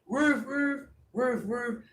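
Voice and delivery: high doubled woofs; imitated dog barks. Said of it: "Even the dogs is out there".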